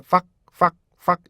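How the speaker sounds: 팍 cut off sharply; abrupt checked 팍; short first syllable. Shown as a demonstration. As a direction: clip the start of find as 팍. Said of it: "첫 음절이 늘어나면 안 돼요".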